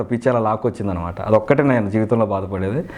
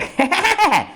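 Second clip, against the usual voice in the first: very high comic giggle; squealed put-on laugh; Kondavalasa. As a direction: mimic a comedian's high cackle. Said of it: "అలానే కొండవాల్స్ గారు".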